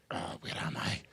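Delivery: breathy, half-asleep mumble of confusion; groggy whisper. Retold or imitated. Waking sick at three in the morning, groggy whisper. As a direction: murmur like you just woke lost in a fever.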